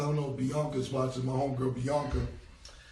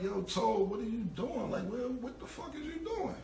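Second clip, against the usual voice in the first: retold shock; 8 semitones higher, 3 dB quieter than usual. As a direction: demand an answer in growing shock. Waking to a stranger in car.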